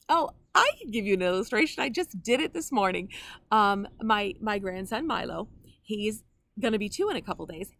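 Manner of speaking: ordinary talk, nothing marked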